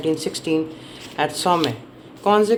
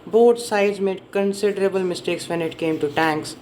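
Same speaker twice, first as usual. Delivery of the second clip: ordinary delivery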